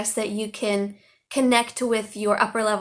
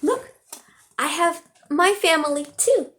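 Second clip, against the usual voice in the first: higher, bright excited exclamation; 6 semitones higher than usual. Retold or imitated. Teacher showing students her family photo, mock excited. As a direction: sound delighted to show your own family.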